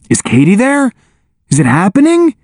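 high, eager questions. A narrator's reading. Brad asking after Katie from his tank, eager. Anxious husband asks about the birth.

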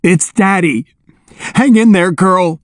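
choked with emotion. A narrator's reading. Brad encouraging his dog in labor, choked with emotion. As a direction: cheer the patient on, choked up. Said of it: "his voice choked with emotion".

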